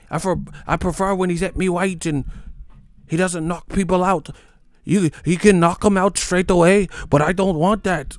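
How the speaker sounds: high mock British accent, pompous preference; mock British fan. Mocking British fans claiming they prefer boxing, high mock accent. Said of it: "they switch up".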